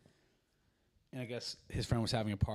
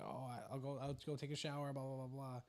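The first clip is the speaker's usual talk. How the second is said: higher, offhand retold quote; a hint of the girlfriend's voice; lightly voiced girlfriend; 4 semitones higher, 3 dB quieter than usual. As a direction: toss it off in a higher voice. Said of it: "she's like".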